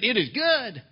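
it is good? shouted high and loud in disbelief; the king can't believe what he heard; king repeats his friend's words in disbelief, loud and high; incredulous. Acted. King stunned by friend's reply.

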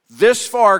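This far punched out loud; emphatic refrain. Acted. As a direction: hit "This far" hard and loud, then drop. Repeated Ebenezer refrain.

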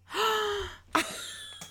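long, high gasp; big sharp intake of breath; dramatic fangirl gasp; swooning gasp. Real, not acted. Reacting to the name Sartak.

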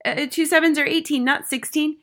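worried pupil pleading his answer; quick second try. A narrator's reading. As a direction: anxiously correct yourself, high-pitched. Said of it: "quickly tried again".

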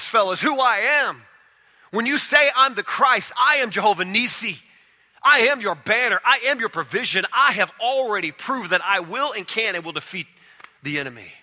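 high, loud proclaiming in God's voice; full-force preaching. Acted. Preacher voicing God to the church, loud and high. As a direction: thunder it out as God's own claim.

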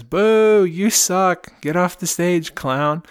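second heckler shouting at the clown, loud jeer. Acted. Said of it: "somebody else in the audience says".

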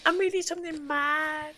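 very high, cooing Pennywise lure; creepy clown. Acted. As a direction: coo it high like a child-luring clown. Clown voice again.

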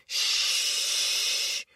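long unvoiced shush. Shown as a demonstration. Kids hushing the class with a shh. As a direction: hush the room with a long shh. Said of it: "the kids will respond by saying".

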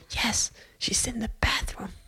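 slow, hushed whisper; retold whisper. Other lady reporting the girl in the bathroom, slow whisper. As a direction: whisper it confidentially, drawing it out.

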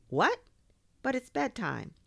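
high, exasperated objection; put-upon kid at bedtime; incredulous protest. Acted. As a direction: protest in disbelief, pitch jumping up. Boy protests water request.

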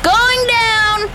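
shouted relay back to the galley; yelling. Acted. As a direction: yell it across the boat. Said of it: "you don't have to yell".

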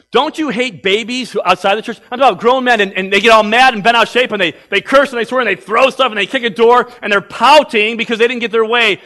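loud, high, hammering sermon; fiery preaching. Acted. Pastor scolds lazy believers, loud fiery preaching. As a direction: preach it hard at the lazy ones.

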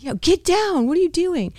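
high, exasperated mock shouting; imitated yell. Wife replaying husband's orders at pull-ups, mock yell. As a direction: mimic a teammate yelling orders in a high voice. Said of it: "He's screaming at me".

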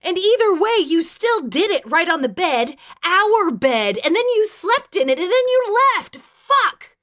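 furious, high, loud rant; high shouted tirade; angry outpouring. A narrator's reading. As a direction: let rage pour out, climbing to a yell. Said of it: "angry word lava poured out".

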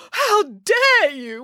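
high, very drawn-out indignant cry; outraged wail. A narrator's reading. Woman answers the dog's owner, wailing. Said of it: "wailed the woman".

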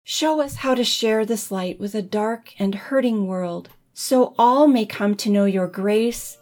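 ordinary delivery

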